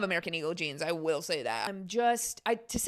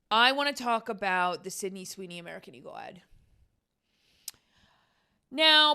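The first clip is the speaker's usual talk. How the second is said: ordinary talk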